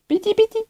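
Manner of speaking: squeaky high gunfire sounds with the mouth; pew-pew the shots out in a high squeak; playful high-pitched shooting noises; mock shooting noises. Imitating gun shots while playing.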